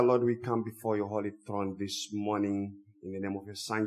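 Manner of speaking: normal talking voice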